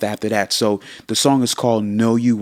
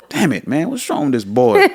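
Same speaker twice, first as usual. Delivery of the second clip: exasperated dad; 8 semitones higher, 4 dB louder than usual. Retold or imitated. Dad's exasperated retold reaction.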